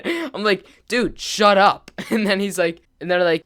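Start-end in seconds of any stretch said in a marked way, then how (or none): 0.9-1.9 loud, high, drawn-out exasperation; exasperated telling-off. Retold or imitated. Teller scolds his provoking friend, acted exasperation. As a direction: re-enact shutting a friend up, slowly and forcefully.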